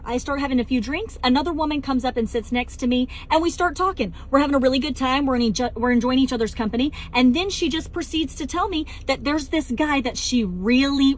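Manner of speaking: ordinary talk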